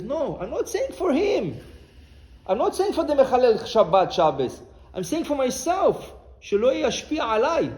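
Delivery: loud, high, impassioned answer; fervent retold rebuttal; emphatic retort. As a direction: insist forcefully, raising the voice. Rabbi retorts forcefully to his students.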